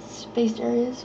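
usual speaking voice

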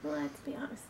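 breathy aside whisper. Real, not acted. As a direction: whisper it wryly. Repeated in whisper.

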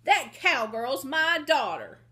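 loud, high protective mother; fierce ranch mother standing her ground; defiant shout. Acted. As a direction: shout down the bully threatening your child. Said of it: "she shouted".

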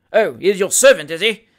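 loud, sneering magistrate. Acted. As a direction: sneer it like a pompous judge.

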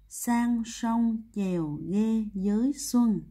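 very slow, word by word; slow recitation. Shown as a demonstration. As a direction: recite the memory phrase with each word drawn out. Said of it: "Cô đọc lại". (đọc thật chậm từng chữ)

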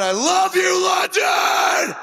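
high shout to the whole crowd; arena shout. Real, not acted. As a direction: yell it out to the hall. Frontman greeting the crowd.

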